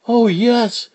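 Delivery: put-on little girl voice; squeaky young child; high child voice. Child character voice.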